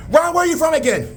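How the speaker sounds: exclaimed high-pitched query; insistent questioning. Acted. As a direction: quiz him in a very high voice. Questioning the man just recognised.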